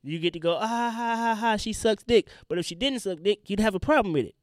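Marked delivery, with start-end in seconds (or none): 0.6-2.3 slow, higher jeering laugh voice; hammed-up smug leaker; mocking gloater. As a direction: mimic a smug guy showing off. Mocking the guy who leaked.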